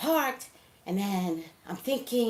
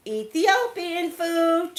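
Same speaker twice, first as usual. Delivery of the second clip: savoring excitement; 10 semitones higher, 6 dB louder, much slower than usual. Real, not acted. Excited about the meal.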